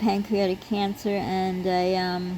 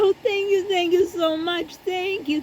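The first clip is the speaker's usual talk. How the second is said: much higher-pitched effusive gratitude; gushing thanks; 10 semitones higher, 3 dB louder than usual. Retold or imitated. Shopper thanks her for sauce advice, high and gushing. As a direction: imitate an overjoyed, grateful shopper.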